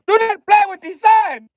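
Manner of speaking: high, loud yell with the stress on do; booming warning shout. Pretend. Warning the Sun Dodgers.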